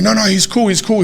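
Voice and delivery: imitating someone calling others off; urgent vouching. Retold kid vouches to hold gang back.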